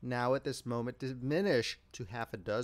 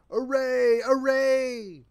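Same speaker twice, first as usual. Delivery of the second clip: high, loud booming call to arms; a voice louder than thunder, crying out; great thundering cry; 10 semitones higher, 9 dB louder, much slower than usual. A narrator's reading. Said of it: "a great voice louder than a thunder peal crying".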